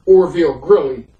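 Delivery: ordinary delivery, nothing marked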